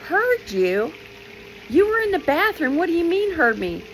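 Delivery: high-pitched, incredulous questioning; retold bewilderment, pitched well up; baffled disbelief. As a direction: act out being completely puzzled. Retells her baffled reply to friend.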